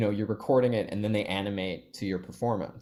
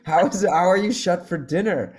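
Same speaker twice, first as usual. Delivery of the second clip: mock baffled outrage; 9 semitones higher, 5 dB louder than usual. Baffled tourists at closed town.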